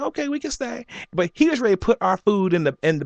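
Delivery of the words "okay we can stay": giving in, high and soft; a sighing okay, pitched up; high soft resigned. Retold or imitated. Answering his mother, a high, soft giving in.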